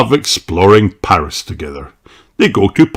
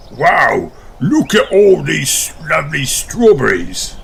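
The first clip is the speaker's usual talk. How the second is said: Captain Dog; 6 semitones higher than usual. A narrator's reading. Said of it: "says Captain Dog".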